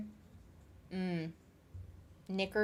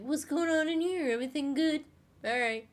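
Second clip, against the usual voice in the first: cat's inner voice; 8 semitones higher than usual. Pretend. Voicing the cat's thoughts.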